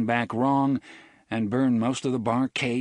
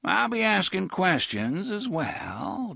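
dreamily; 10 semitones higher, slower than usual. A narrator's reading. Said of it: "Jonas said dreamily".

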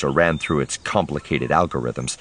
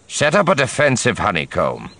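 general's order; 5 semitones higher than usual. Acted. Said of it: "he ordered the lieutenant".

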